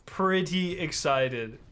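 "Pretty excited" stretched out slowly; slow, savoured "Pretty excited"; drawn-out emphasis. Real, not acted. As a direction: draw out "Pretty excited" at half speed.

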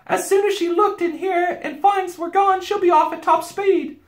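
very high, loud warning; anxious Susan. A narrator's reading. Girl warning of pursuit, high and urgent. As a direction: warn them urgently up high. Girl warns about witch.

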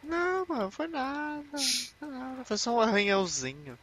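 high, breezy fake reassurance; mock denial voice. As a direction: brush off a bite in a high voice. Mocking bitten character's denial.

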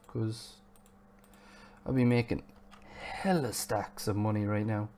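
ordinary talk, nothing marked

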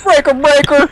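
radio call shout. Pretend.

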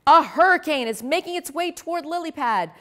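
high, urgent anchor announcement; news alert voice. Acted. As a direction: announce it live to the town. Live news alert on air.

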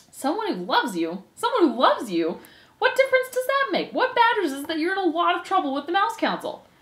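high, swooping mock echo turning to scolding; exasperated mocking. Furlow scolds his lovestruck brother, exasperated and mocking. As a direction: pitch it up in exasperated disbelief.

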